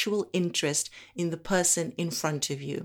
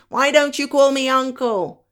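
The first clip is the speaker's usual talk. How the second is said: loud, high-pitched retort; indignant challenge; 8 semitones higher, 8 dB louder than usual. Acted. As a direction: throw the question back forcefully. Acts out another person objecting.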